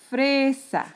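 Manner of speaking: "fresa" with FRE held, falling on "sa"; sílaba tónica marcada. Shown as a demonstration. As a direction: lean on the first syllable of "fresa". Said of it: "voy a subrayar la sílaba tónica". ("fresa" con la FRE alargada y marcada)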